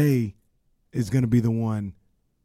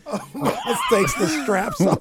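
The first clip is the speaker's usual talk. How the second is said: laughing; much slower than usual. Real, not acted. Joke about Omos winning.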